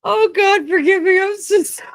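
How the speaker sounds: helpless high-pitched laughter breaking through the words; can't stop laughing, barely gets words out; crying laughing. Real, not acted. Streamer to chat, cracking up at a joke, crying with laughter. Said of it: "I'm crying".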